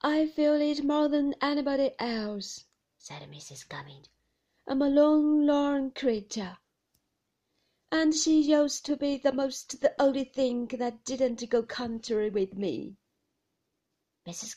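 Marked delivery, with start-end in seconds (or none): 0.0-2.7 louder, higher complaining moan; a self-pitying old woman; moaning widow. Acted. Missus Gummidge moans about missing Emily, self-pitying. 4.7-13.0 wallow in her misery; lone lorn moan. Acted. Missus Gummidge wails to the household, self-pitying.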